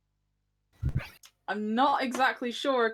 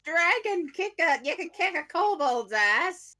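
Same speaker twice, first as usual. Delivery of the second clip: put-on old lady cheering; 8 semitones higher than usual. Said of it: "bloody Helena be like".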